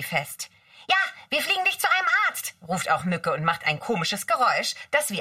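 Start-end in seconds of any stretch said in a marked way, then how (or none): 0.9-2.6 high, fast elf voice; Mücke's voice. Acted. Mücke calls out to the seal pup, high and fast. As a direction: zip through it high like a tiny fairy.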